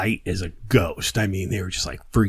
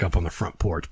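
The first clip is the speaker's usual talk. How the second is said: normal talking voice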